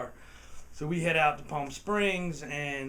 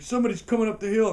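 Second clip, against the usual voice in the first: panicked warning; 7 semitones higher, 8 dB louder, much faster than usual. Retold or imitated. Tim alarmed at approaching cops.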